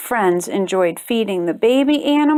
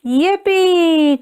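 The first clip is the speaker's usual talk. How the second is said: gleeful cheer, higher; 6 semitones higher than usual. A narrator's reading. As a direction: kids whooping on the ride. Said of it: "cheered the kids".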